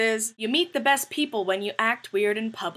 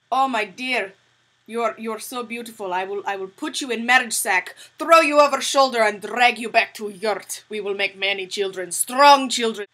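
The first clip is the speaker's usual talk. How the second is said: mock Russian suitor; 7 dB louder than usual. Teasing a friend in the elevator with a loud mock Russian proposal. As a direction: play a Russian caveman suitor in a mock accent. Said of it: "I decided I would be a jackass".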